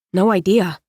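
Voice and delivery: usual speaking voice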